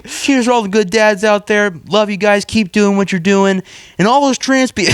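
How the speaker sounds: high, chirpy imitation of a dad's sign-off; mock cheerful tone. Host mocking a dad's Father's Day message, high mock-friendly imitation. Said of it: "The tone switch is crazy".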